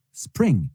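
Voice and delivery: Spring with the P punched, falling tune; stress on the P. Shown as a demonstration. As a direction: hit the P in Spring as a model to repeat. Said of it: "il faut accentuer sur le P". (Spring en accentuant le P)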